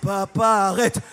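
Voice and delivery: kids voiced lightly. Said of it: "ils disent".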